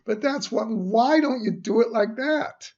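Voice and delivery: loud, very high-pitched pleading annoyance; exasperated protest. Real, not acted. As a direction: protest as if nobody listens. Still ranting after apologizing.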